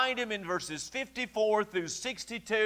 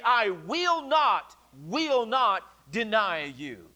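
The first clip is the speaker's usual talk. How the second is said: "will not" drawn out slowly and repeated; slow, deliberate pledge with weight on "will not"; emphatic vow; much slower than usual. Retold or imitated. Peter's emphatic vow, retold.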